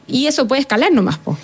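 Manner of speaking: ordinary talk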